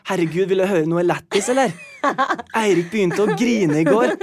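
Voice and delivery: lightly voiced mother sharing gossip; mother teasing. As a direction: hand it out as juicy gossip. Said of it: "Første hun sier at".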